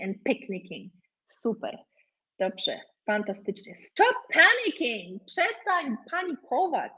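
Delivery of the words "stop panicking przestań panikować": high, drawn-out mock exhortation; acted dramatic plea. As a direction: plead theatrically, slow and high-pitched. Odgrywany apel o spokój, przykład. (teatralny, wysoki, przeciągły apel)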